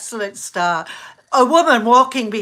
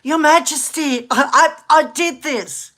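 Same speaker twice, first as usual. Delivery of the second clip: retold yell out; 6 semitones higher than usual. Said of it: "And I yell out".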